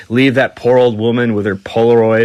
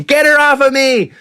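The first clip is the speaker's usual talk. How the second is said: screaming; 14 semitones higher than usual. Retold or imitated. Mick pinned by the old lady, mock screaming. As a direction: yell for help, hammed up. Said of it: "Mick's screaming".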